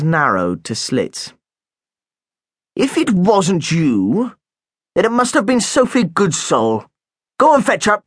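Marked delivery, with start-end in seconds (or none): 2.7-8.0 a hint of a stern headmaster, raised pitch; suspicious head teacher questioning a pupil; lightly voiced headmaster. Headmaster accusing.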